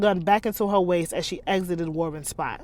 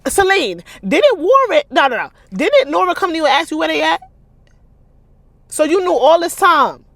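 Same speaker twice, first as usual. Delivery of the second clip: loud, high, rapid indignant rant; outraged disbelief; 9 semitones higher, 9 dB louder than usual. Real, not acted. Reader scolding Celine for hiding it, loud outrage. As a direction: call the character out in disbelief.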